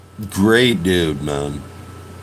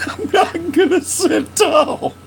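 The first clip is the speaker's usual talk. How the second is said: laughing; 10 semitones higher than usual. Real, not acted. Laughing repeat of joke.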